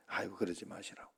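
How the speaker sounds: hushed, imploring plea to stop; retelling a quiet plea to two angry men; soft pleading. Retold or imitated. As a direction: gently beg them to calm down. Pastor begging quarrelling men to stop.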